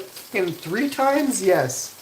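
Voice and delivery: high, loud, drawn-out acceptance; eager yes. Acted. Man answering an imagined offer, hammed-up eagerness. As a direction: play it over-keen, stretching the words.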